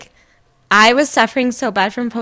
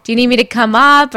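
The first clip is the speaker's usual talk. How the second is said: quick, louder, higher run of anxious check-in questions; eager caring sister-in-law; 4 semitones higher, 8 dB louder than usual. Retold or imitated. Sister-in-law hovering over new mom, eagerly checking in. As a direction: pepper her with questions, overeager. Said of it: "I'm like".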